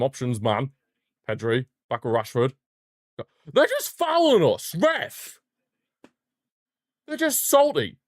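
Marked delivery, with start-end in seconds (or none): 3.5-8.0 much higher pitched outraged cry; indignant yell at ref. Real, not acted. Fan yelling at the referee over constant fouls, indignant high cry. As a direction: protest to the referee like a wronged fan.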